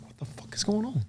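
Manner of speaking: hushed, breathy retold whisper. Walking in, seeing every door open, whispered. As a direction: mutter it under your breath, puzzled.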